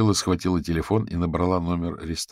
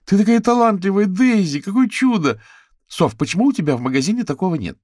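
high, loud delighted gush; excited, high-pitched compliments; gushing praise; 14 semitones higher, 8 dB louder than usual. A narrator's reading. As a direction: squeal with admiration for a friend's work.

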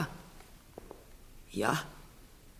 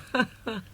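short high scoffing laugh; 11 semitones higher than usual. Acted. Marchioness scoffs.

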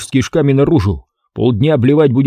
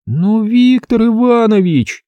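"Ну, Виктор Иванович" drawn out slowly, much higher, whining; plaintive drawn-out plea; 12 semitones higher, slower than usual. A narrator's reading. Girl begs her boss to let her join, slow and whining. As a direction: stretch "Виктор" out like a pouting girl begging. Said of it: "протянула та, жалобно так".

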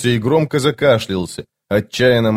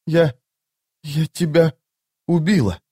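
high, halting horror with pauses; horrified realization; 6 semitones higher than usual. A narrator's reading. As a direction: break the words apart in dawning horror. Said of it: "девушка с ужасом подняла руку". (с ужасом, высоко и прерывисто)

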